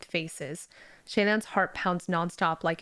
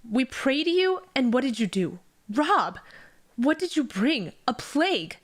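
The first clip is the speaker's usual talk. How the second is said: builds higher and louder to a cry; rising outrage; 6 semitones higher, 4 dB louder than usual. A narrator's reading. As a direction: hurl the accusation at the god. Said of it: "The man continues".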